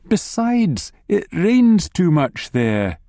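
slow, high whiny moan. A narrator's reading. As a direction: complain slowly. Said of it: "you moaned at your mother-in-law".

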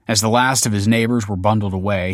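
plain reading voice

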